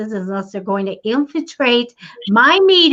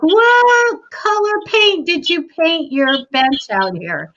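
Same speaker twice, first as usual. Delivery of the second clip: higher, drawn-out mock question; mock small talk; 7 semitones higher, slower than usual. Woman at the door asks about bench paint, mock small talk. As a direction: stretch the opening high, let the end drop away. Retells the visitor's stalling chatter.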